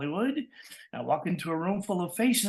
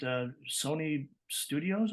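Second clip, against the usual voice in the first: usual speaking voice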